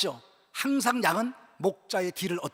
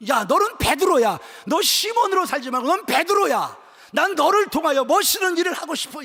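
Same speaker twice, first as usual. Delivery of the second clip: raised, loud voice of Jesus calling Peter; impassioned commissioning; 6 semitones higher, 6 dB louder than usual. Acted. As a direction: proclaim a new calling with force.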